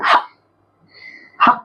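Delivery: "ह" as a short isolated ha; short "ह", a breath of h into a vowel; letter sound demo. Shown as a demonstration. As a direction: sound out "ह" twice with a pause between. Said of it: "ह का उच्चारण".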